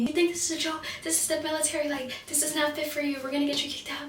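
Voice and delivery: high, rattled-off imitation of yelling; retold yelling. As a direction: mimic a telling-off without raising the volume. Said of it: "They were just yelling at me".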